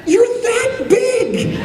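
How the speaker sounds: high, drawn-out cry of mock disbelief; hammed-up, high-pitched punchline through laughter; acted laughing exclamation. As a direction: stretch it out slowly, squealing with laughter.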